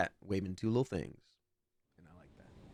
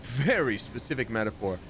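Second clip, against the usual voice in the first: ordinary delivery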